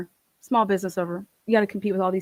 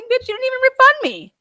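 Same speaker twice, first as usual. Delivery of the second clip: much higher and louder, outraged; indignant outburst; 14 semitones higher, 5 dB louder than usual. Retold or imitated. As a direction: fire it back at the clerk. Retold quarrel with the clerk.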